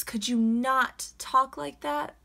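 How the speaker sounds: slow, high, prissy commenter voice; mocking commenter. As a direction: read the comment slow and snooty.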